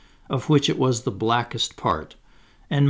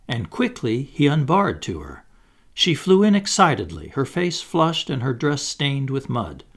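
plain reading voice

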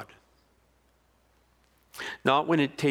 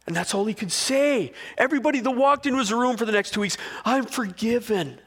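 high, loud, passionate preaching; full-force preaching; 10 semitones higher, 4 dB louder than usual. Acted. Preacher building to a loud, high peak. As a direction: preach it out to the crowd, climbing.

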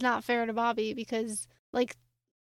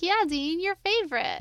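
higher lilting taunt, rising on Dean; sing-song teasing; 6 semitones higher than usual. Retold or imitated. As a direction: tease it like a playground chant. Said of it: "like, a sing-song".

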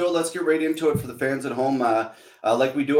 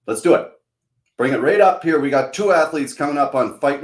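louder, higher hype; hyped host; 4 semitones higher, 6 dB louder than usual. Real, not acted. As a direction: pump up the audience. Hyping up the show segment.

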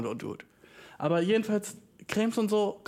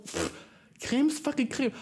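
mock defiant; 8 semitones higher than usual. Retold teen self defiant.

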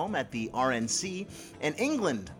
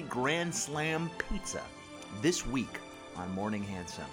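announcer voice; 3 semitones lower, 5 dB quieter than usual. Acted. Announcer sign-off, slow and low.

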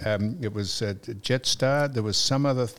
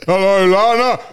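loud, raised mock greeting; imitating Don; 7 semitones higher, 8 dB louder than usual. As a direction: boom it out high, imitating a grumpy caller. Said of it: "wait till Don speaks to her".

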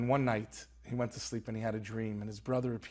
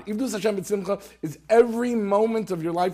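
preaching fervor; 9 semitones higher, 10 dB louder than usual. Real, not acted. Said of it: "preaching climax".

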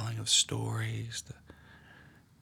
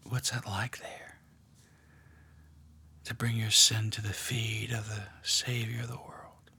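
guided meditation. Acted. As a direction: draw them gently into the scene. Leads a guided prayer meditation.

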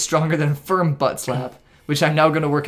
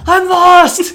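high, loud panicked bug cry; panicked yell; 10 dB louder than usual. Retold or imitated. Retelling the Bugs Life scene, a high panicked yell. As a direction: shriek it like a lost little bug.

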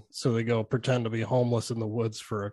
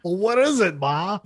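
higher, louder mock teen snapping at his mom; mock hothead snap; 8 semitones higher, 5 dB louder than usual. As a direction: fire it back like an irritated kid. Imitating Ricky snapping at mom.

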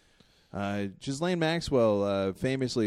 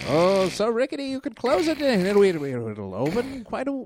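carnival barker; 11 semitones higher than usual. Acted. Barker hyping the rickety screen door.

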